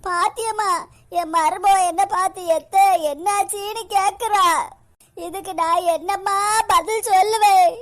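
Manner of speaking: melodramatic tearful appeal to mother; crying. Acted. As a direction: wail the question through stage sobs. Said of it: "எதுக்கு அவங்க அழுதுகிட்டே இருக்காங்க?". (அழுதுகொண்டே அம்மாவிடம் முறையிடுவது)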